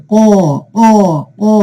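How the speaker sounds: mimicking a heron's repeated throaty call; imitated heron call. Shown as a demonstration. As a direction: call out like a heron, in separate bursts. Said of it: "বক যেভাবে ডাকে".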